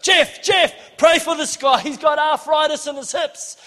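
loud, high plea for help; urgent calling. Retold or imitated. Grabbing the pastor to pray for someone, urgent shout. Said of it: "I was like this".

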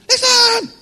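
very high, loud soprano shriek; mock soprano. Preacher playing God shouting at a dreamer, loud mock soprano. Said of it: "go with my soprano voice".

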